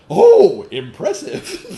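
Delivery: sarcastic impressed. Retold or imitated. Sarcastic reaction to weak claim.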